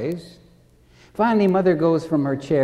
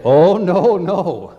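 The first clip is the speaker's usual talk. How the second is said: loud, hammed-up cry of protest; mock alarmed refusal; 4 semitones higher, 6 dB louder, slower than usual. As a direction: imitate a scared patient refusing. Frightened patient refusing the dentist.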